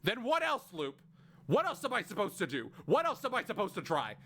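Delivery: high, pressing, frantic questions. Acted. Siffrin begging Loop for answers, frantic and high. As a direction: pile the questions on, desperate.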